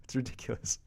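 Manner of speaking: laughed out softly, almost whispered; breathy laugh. Real, not acted. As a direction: giggle it out under your breath. Laughing about daily snow forecast.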